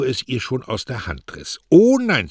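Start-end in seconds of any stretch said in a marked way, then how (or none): 1.7-2.3 excited protest. Acted. Mario snatches card, protests.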